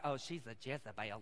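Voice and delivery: low, hushed Southern gossip; Southern ladies. Retold or imitated. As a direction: mutter it like a disapproving Southern lady. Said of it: "the Southern ladies out around be like".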